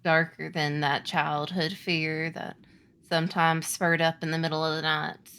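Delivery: low, slow, hushed scene setting; game master's creepy hush; ominous narration. Acted. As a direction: draw them into the dark, slowly.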